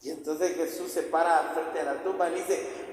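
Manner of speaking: normal talking voice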